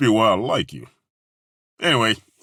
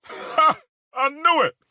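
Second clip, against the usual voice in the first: triumphant glee; 12 semitones higher than usual. Acted. Triumphant laugh.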